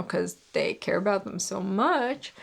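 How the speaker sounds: dry, sarcastic dig at them. Real, not acted. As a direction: say it with heavy sarcasm. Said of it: "I'm being sarcastic".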